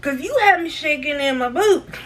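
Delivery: sassy inner retort. Retold or imitated. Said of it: "I said it in my head".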